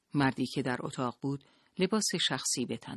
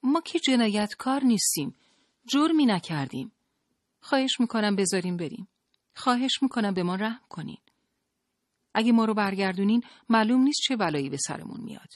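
high, louder, urgent pleading; desperate appeal in a raised, higher voice; 8 semitones higher, 5 dB louder than usual. A narrator's reading. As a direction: beg them to show mercy.

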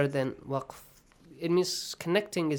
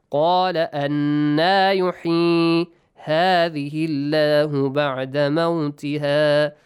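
measured, louder tajweed recitation; Quranic recitation; 6 dB louder than usual. Shown as a demonstration. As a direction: recite with long held vowels. Recites the verse being taught.

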